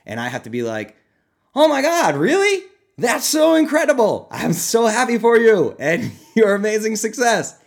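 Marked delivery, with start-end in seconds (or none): 1.5-7.6 high, loud, hammed-up gushing; fake excitement. Coach fakes thrilled joy for a client. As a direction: overplay delight at the client's news. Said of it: "I can't, like, fake".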